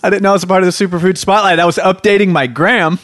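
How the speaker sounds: high, loud and fast protest; indignant protest. Real, not acted. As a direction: squeal it out, offended. Mock offended protest.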